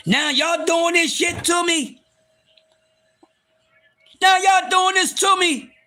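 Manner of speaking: high, wounded, hammed-up protest; mock heartbreak. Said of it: "I'm about to cry".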